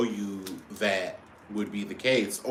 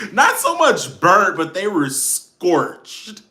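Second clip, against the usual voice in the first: higher, louder, slow scorched with laughter; laughing emphasis; 9 semitones higher, 9 dB louder, slower than usual. Real, not acted. Mocking Paula's burnt wings, laughing.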